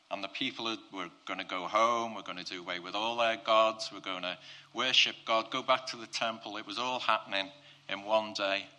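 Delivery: normal talking voice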